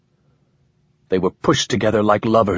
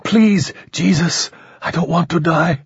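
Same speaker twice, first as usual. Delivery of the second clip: pleading; 8 semitones higher than usual. A narrator's reading. Dying man pleads.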